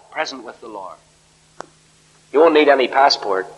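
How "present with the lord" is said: very soft, reflective echo; hushed repeat. Real, not acted. Preacher repeating Paul's words to the congregation, hushed. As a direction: drop right down and let it settle.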